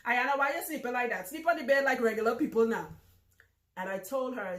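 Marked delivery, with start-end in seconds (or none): 0.1-2.9 scolding Trinidadian mother, louder and higher; Trinidadian accent. Retold or imitated. Mom asks daughter why she sleeps sideways, in a Trinidadian accent. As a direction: imitate a mum's loud Trinidadian telling-off. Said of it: "In, in her Trinidadian accent".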